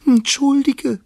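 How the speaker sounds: very high, loud little squeak; squeaking mouse. A narrator's reading. Mouse apologising to the angry lion, squeaking. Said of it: "piepste die Maus".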